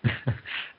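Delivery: breathy, quiet chuckle; helpless little laugh before speaking; stifled real laughter. Real, not acted. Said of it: "I'm laughing because".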